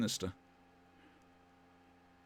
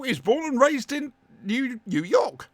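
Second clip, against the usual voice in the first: put-on New York accent; 10 semitones higher, 5 dB louder than usual. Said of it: "judge my New York accent".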